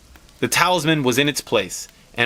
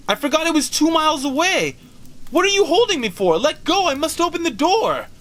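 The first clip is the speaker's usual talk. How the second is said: much higher, breathless desperate voice; frantic pleading. Acted. Mother struggling in husband's grip toward the door, frantic. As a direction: plead wildly while struggling free. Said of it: "struggling mechanically".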